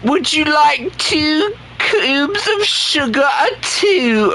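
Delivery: high, slow, hammed-up British host; a silly high tea-party voice; mock posh British. Streamer mocking British viewers with a fake tea offer, hammed-up British.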